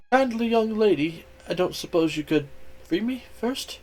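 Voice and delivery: high, small, hesitant ask; tentative little request; sheepish mouse. Acted.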